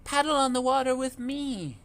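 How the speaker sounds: sing-song calling mother duck. A narrator's reading. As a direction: call them over, mama duck. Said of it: "Mummy called again".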